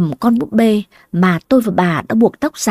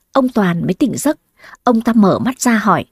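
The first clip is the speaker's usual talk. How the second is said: ordinary delivery, nothing marked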